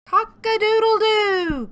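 very high, loud crow; crowing rooster. A narrator's reading. Storyteller as Rooster, loud high crowing. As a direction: crow it out at the top. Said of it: "crowed Rooster".

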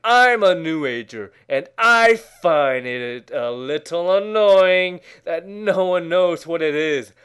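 usual speaking voice

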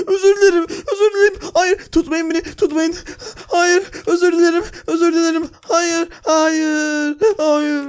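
high, pleading voice; pleading prisoner. Acted. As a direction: beg them not to drag you away. Prisoner begs while dragged off. (yüksek sesle yalvaran mahkum)

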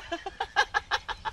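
high unvoiced giggle; breathy giggle. Real, not acted. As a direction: giggle ahead of the joke. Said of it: "Tao cười trước nha".

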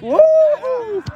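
loud, very high "woo"; long high-pitched whoop; cheering whoop. Real, not acted. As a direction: whoop for the team. Cheering at the match.

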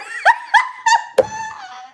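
high, loud cackle; shrieking laugh. Real, not acted. Joking that even the old lady gets hit on, shrieking laugh. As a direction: burst out laughing at her own joke.

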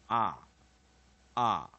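"啊" as an open "ah", punched loud; loud isolated vowel demo, "啊"; short O vowel. Shown as a demonstration. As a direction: model short O as a firm "啊". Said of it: "短母音O就念啊".

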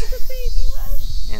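high, loud, fast squeal; excited squeal. Real, not acted. Squeals at baby monkey.

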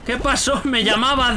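usual speaking voice

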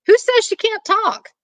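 high-pitched indignant comeback; acted high yell in reply; yelling back. A narrator's reading. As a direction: holler it high, as if from upstairs. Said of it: "So Stella Rondo yells back".

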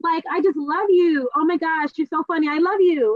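put-on high, bubbly, gushing girlfriend voice. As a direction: gush over her like an overexcited friend. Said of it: "she's always really chipper".

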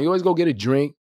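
ordinary delivery

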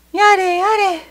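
high, sing-song comforting voice; soothing mother. Acted. Mother soothing the crying boy while tying up his finger, singsong. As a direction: croon it to calm the child. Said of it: "とおっしゃって".

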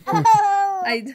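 high, loud squealing laugh; grossed-out laugh. Real, not acted. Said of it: "I really feel like I'm going, "Ugh".

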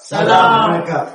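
slow, loud drawn-out group salute; drawn-out scout salute; called-out greeting. Real, not acted. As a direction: call it back loud and slow like a troop answering.

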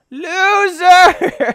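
mock the friend through a laugh; laughing taunt. Real, not acted. Gamer taunting an absent friend, laughing.

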